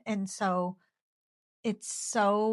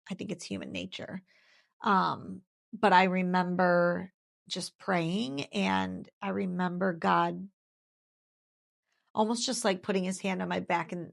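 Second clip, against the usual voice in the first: normal talking voice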